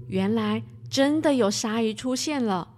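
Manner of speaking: ordinary delivery, nothing marked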